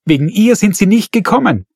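high loud shriek; frantic mother screaming an accusation. A narrator's reading. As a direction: shriek it out in panic.

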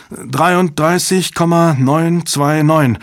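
ordinary delivery, nothing marked

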